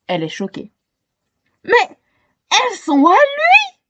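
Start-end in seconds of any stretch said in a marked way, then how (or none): 1.6-3.8 high, outraged cry; shocked cry. A narrator's reading. Scandalised girl cries out to the crowd. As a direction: cry out in disbelief. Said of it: "s'écrie-t-elle".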